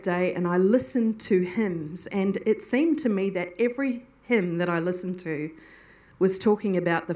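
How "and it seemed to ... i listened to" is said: ordinary talk, nothing marked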